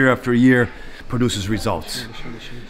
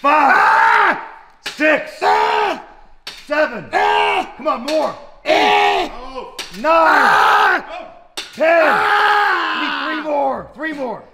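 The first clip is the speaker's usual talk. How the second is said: shouting coach; 9 semitones higher, much slower than usual. Real, not acted. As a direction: drive them hard with yells. Trainer yelling the final reps.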